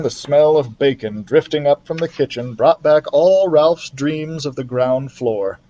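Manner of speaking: ordinary delivery, nothing marked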